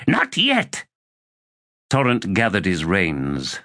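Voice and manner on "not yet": not yet pushed much higher and thinner; rising higher repeat. A narrator's reading. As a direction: climb up on the second yet. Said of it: "repeat climbs higher".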